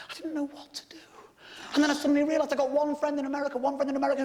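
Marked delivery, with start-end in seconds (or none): 0.1-1.0 whispered despair; hushed, lost. Real, not acted. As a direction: drop to a broken whisper.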